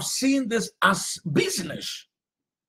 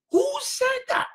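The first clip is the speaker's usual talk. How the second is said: fiery preacher's rebuttal; preaching shout; 7 semitones higher than usual. Acted. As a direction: demand an answer, then fire back.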